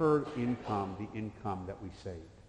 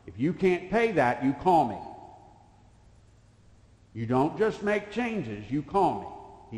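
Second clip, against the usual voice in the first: firm loud order; 6 semitones higher, 8 dB louder, slower than usual. Retold or imitated. Adviser's firm orders, retold.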